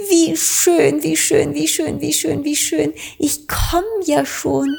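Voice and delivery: hohe, eifrige Vogelfrauenstimme; Amselfrau. A narrator's reading. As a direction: aufgeregt und hoch als Amselfrau. Amselfrau eilt zur Tür. (Amselfrau eilt hoch und eifrig zur Tür)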